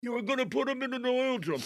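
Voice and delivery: squeaky mock gangster repeating the threat; mobster's reply. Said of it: "Vinny, what did I say?".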